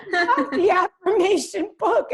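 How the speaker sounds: high, loud, laughing and slow; excited mom impression. Daughter imitating her mom gushing over the affirmation book. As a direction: gush like an excited mother.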